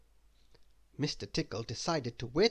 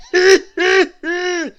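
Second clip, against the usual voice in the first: high, loud wheezy giggle; wheezing dragon giggles; wheezing laugh; 16 dB louder, slower than usual. Acted. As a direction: dragon out of breath from tickling. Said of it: "wheezed the dragon".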